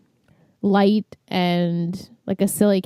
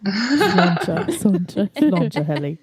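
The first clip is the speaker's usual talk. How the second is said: deep-voiced impression; slower than usual. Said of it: "Amber does a great impression".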